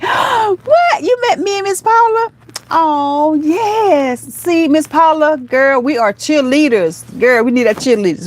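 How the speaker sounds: high-pitched squeal of delighted surprise, easing into drawn-out cheering; thrilled high reaction, words stretched out; excited gushing. Real, not acted. Reading a fan's message, delighted reaction.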